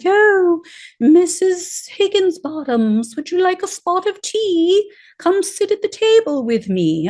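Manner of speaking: put-on a genteel English hostess pouring tea, in an English accent; prim English accent. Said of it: "I don't know why I made the judge English".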